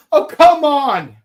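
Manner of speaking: high, loud mock exasperation; high and loud, playfully fed up; exasperated come on. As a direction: throw up your hands at the joke. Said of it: "I'm like".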